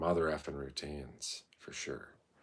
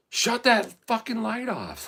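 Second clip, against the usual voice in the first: high, slow mock shout; imitating a yell; 5 dB louder, slower than usual. Mail thief yelling at him to kill the flashlight. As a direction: bark it like a thief caught out. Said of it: "what did they yell at me? They go".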